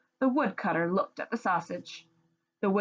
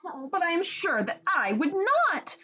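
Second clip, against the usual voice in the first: cried out; 10 semitones higher than usual. Acted. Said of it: "cried the woodcutter's wife".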